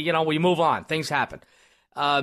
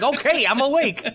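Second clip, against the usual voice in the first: acted startled reaction; 6 semitones higher than usual. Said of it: "And I was like".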